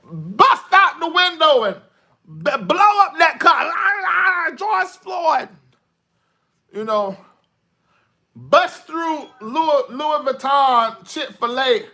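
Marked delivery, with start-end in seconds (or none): 0.0-5.5 high, loud hollering with drawn-out wailing; acted rioter's outburst. Acting out rioters' way of expressing. 8.4-11.8 high, slow, stretched-out hollering; acted looter's holler. Acting out looters, mocking outburst.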